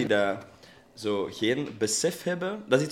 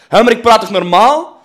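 much too loud, raised pitch; te luid praten; 9 semitones higher, 16 dB louder than usual. Retold or imitated. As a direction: protest at oblivious full volume. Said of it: "het volume van een ruimte niet kunnen inschatten". (veel te luid praten)

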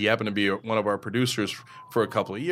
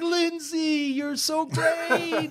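high, drawn-out sycophantic greeting; exaggerated two-faced sweetness; fake gushing; much slower than usual. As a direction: fawn over the star in a put-on squeal. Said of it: "all those same people turn on a dime".

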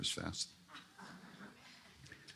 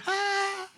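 playful guru exclamation. Retold or imitated. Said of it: "he looks and he goes".

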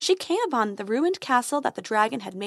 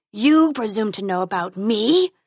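growling dragon guarding treasure; 5 dB louder than usual. Acted.

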